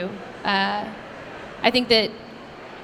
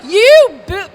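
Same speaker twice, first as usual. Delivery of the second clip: mock outraged yell; 9 semitones higher, 10 dB louder than usual. Friend cursing, loud.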